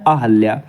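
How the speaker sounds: low-pitched अहल्या with "hal" in the middle; correct pronunciation. Shown as a demonstration. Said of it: "अहल्या होता है".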